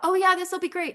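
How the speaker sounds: high, light and carefree; breezy optimism. Retold or imitated. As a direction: toss it off like it's easy. Her naive earlier expectation.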